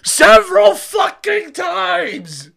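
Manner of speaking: exasperated high shout. Real, not acted. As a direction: blow up at the absurdity. Ranting about Super Bowl ads.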